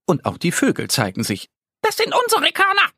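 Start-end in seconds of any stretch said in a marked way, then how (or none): 1.8-2.9 very high, fast, agitated chirping birds; excited bird chorus. A narrator's reading. The birds catch the squirrel thief, high excited cry. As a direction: squeak it out like angry little birds. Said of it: "rufen sie aufgeregt".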